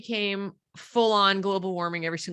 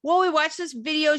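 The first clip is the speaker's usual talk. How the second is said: higher child voice, lightly imitated; lightly voiced daughter, pitched up; daughter explaining; 7 semitones higher than usual. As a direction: recount it as a kid would. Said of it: "She says".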